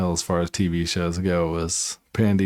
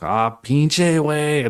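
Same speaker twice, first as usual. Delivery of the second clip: slow, drawn-out mock Mexican slang; imitating his friends' Mexican Spanish, slowly; Mexican accent; 5 semitones higher, much slower than usual. Friends teaching him slang, slow imitated Mexican accent. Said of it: "talk like that".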